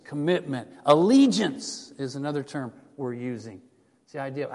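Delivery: usual speaking voice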